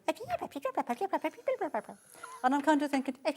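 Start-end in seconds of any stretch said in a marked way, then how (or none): none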